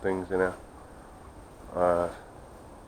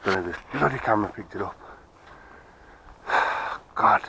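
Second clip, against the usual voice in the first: ordinary delivery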